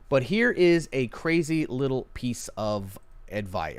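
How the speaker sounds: usual speaking voice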